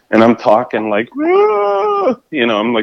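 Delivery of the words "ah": a sudden very high shrieking "Ah"; high retold scream. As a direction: let out a piercing mock shriek. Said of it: "screaming like that".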